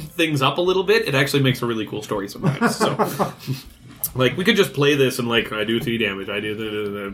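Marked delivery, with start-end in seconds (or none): 5.5-7.1 low, dull mock recital; mock boredom. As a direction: rattle off game moves without interest, dropping low. Mimics bland play without storytelling.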